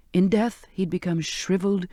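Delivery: ordinary delivery, nothing marked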